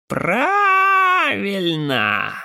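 high, long-drawn raven-like croak; каркающий голос. Acted. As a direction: stretch it out like a crow's cry. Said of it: "Каркающим голосом, так похожим на недавний крик ворона". (каркающим голосом, как крик ворона)

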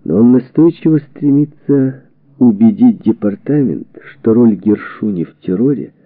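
plain reading voice

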